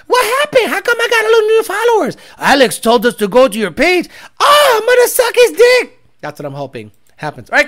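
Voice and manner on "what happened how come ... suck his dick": high squealing mock girl voice, shouting at the end; mock excited girl. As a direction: ham up a thrilled young woman. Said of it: "She goes".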